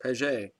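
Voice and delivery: "Paget" as pah-ZHAY, French-style; two-syllable French "Paget", pah-ZHAY; French pronunciation. Shown as a demonstration. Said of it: "that's the way you say it because of the little accent".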